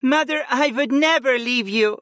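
put-on high, earnest young woman; earnest daughter reassuring mother; insistent princess. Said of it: "Freya insisted".

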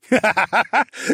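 loud manic cackle; maniacal laugh. Acted. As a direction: laugh like a villain. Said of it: "laugh maniacally".

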